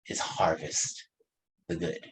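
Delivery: "harvest" breathed as a whisper, "the good" soft; hushed emphasis on "harvest the good". Shown as a demonstration. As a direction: drop to a hush on "harvest". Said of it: "stresses the key phrase".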